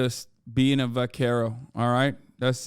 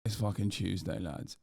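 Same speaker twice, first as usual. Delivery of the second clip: much lower and softer, dropping away; low mock announcement; 5 semitones lower, 10 dB quieter than usual. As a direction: rumble it low to the lads. Repeated Tuesday bit.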